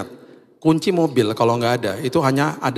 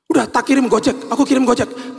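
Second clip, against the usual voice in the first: high-pitched, insistent mock wife; flustered offer; 11 semitones higher than usual. Wife offers to send the key by courier, high and flustered. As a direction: imitate her anxiously offering a fix.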